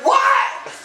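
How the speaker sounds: re-enacted disbelief, very high; high-pitched mock outrage; incredulous. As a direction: react as if she can't mean it. Acting his disbelief at her request.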